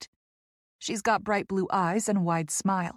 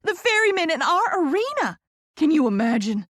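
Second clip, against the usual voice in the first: very high, loud thrilled gush; squealing excitement; 8 semitones higher, 5 dB louder than usual. A narrator's reading. Poppy imagining the band in their arena, squealing excitement. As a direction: squeal it like a giddy fan. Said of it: "She all but squeals with excitement".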